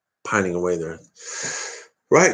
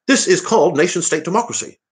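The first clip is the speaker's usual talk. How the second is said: a second go at Farage, pitched much higher; Farage impression; 8 semitones higher than usual. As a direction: push the Farage voice higher and lay it on thicker. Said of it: "I can't do it".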